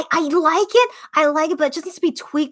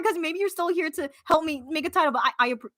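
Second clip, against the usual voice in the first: usual speaking voice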